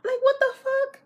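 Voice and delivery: high, loud what the fuck; outraged squeal. Real, not acted. Scolding her past self, loud and high. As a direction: shriek it at your own naivety.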